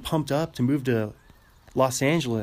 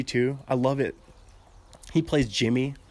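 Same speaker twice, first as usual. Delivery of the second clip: ordinary talk, nothing marked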